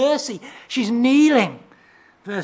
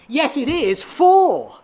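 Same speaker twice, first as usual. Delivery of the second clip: feisty; 6 semitones higher, 5 dB louder, much slower than usual. Retold or imitated. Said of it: "her words are more feisty than that".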